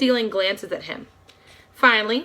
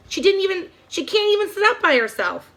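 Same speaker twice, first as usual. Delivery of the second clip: high, whiny sneer; petulant; 10 semitones higher than usual. A narrator's reading. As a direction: pout it out, spoiled. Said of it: "her voice petulant".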